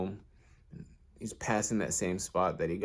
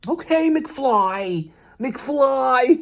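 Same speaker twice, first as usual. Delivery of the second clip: very high, slow, loud taunting bully, then a laugh; Biff impression; 8 dB louder, much slower than usual. The bullies sound like the movie bully, slow loud high taunt. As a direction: drag out the taunt like a movie jerk. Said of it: "He's on his biff".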